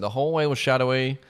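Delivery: ordinary delivery, nothing marked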